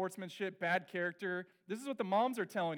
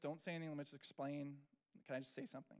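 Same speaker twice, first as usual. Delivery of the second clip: much softer, gentle asking; soft sincere plea; 4 semitones lower, 12 dB quieter, faster than usual. Retold or imitated. Father quietly asks daughter to let him explain, soft. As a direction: ease into the apology.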